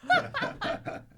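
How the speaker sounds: real delighted squeal; high squealing laugh. Real, not acted. Burst of laughter.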